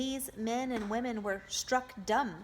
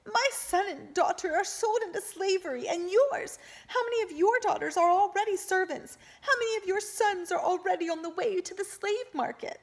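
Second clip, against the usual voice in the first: bitter accusation; 12 semitones higher, 7 dB louder than usual. A narrator's reading. Grieving mother accuses the crowd.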